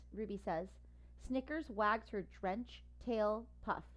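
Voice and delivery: ordinary delivery, nothing marked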